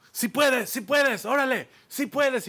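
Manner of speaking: high, loud coach-like shouts; cheering encouragement. Retold or imitated. Athlete helpers cheering on a struggling climber, loud high shouting. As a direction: pump him up, shout it to push him on. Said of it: "Te van motivando así".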